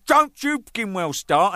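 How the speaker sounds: loud, slow, high retort; slow, exasperated telling-off; retold angry warning. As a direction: warn him off, acting out the row. Retold warning to Bruce in argument.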